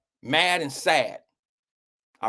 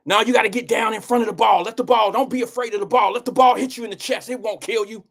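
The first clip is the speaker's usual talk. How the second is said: coach yelling instructions; 8 semitones higher than usual. Retold or imitated. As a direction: bark it like a youth baseball coach. Coach voiced in story.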